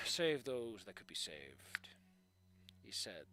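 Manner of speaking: story narration. Acted. Streamer reading a fanfic to chat, soft narration. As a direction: read the story evenly and quietly.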